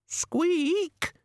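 very high tiny squeak; rat squeak. Acted. Death of Rats squeaks.

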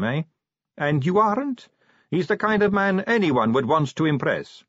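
put-on high, breezy young woman voice; chatty, light-hearted vampire girl. Sally voiced.

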